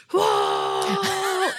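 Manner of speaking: imitating a rowdy group's sudden high whoop; mock crowd shout. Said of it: "a group that goes".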